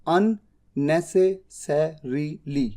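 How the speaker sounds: Unnecessarily spelled out syllable by syllable, very slowly; careful pronunciation. Shown as a demonstration. Teacher models Unnecessarily very slowly for students. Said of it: "जिस तरह से लिखा है उसी तरह से बोलिए".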